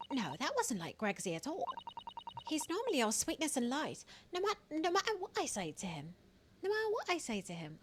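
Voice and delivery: high, soft character voice; put-on character voice. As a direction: pitch it up high and keep it light. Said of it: "that was terrible".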